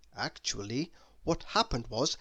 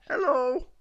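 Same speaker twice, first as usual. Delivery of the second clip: Mister Muddle hello; 10 semitones higher, 5 dB louder than usual. A narrator's reading. Mister Muddle's correct greeting.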